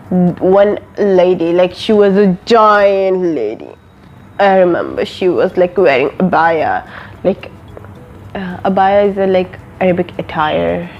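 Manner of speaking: ordinary delivery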